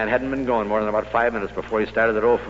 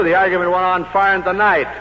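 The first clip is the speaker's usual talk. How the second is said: ordinary talk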